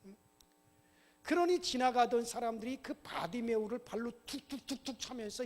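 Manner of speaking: ordinary talk, nothing marked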